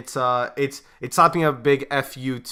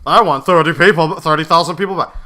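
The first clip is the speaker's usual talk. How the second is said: loud, puffed-up little man; a short man straining to sound big; mock Churchill bellowing his demand; short guy speaking loudly; 6 dB louder than usual. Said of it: "a really short guy that's trying to speak loudly".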